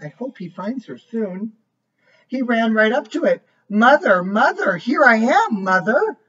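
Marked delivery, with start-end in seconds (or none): 2.3-3.4 lively storybook voice, each line set apart; reading to a child. A narrator's reading. Reads picture book aloud. 3.7-6.2 louder, eager calls; calling out. A narrator's reading. Bird calls to machine.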